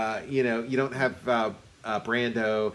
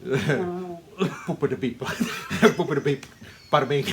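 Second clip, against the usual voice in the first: mock Italian; 3 semitones higher than usual.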